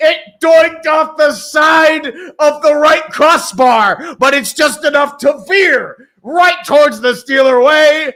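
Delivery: loud, slowed-down replay call; excited replay call. Real, not acted. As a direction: savor the replay like a hyped commentator. Announcer replay description.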